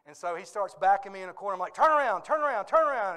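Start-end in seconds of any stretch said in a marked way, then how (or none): 1.7-3.2 loud, high, urgent repeated command; acting out panic at a cornering horse; mock-frantic shout to turn it round; panicked pleading.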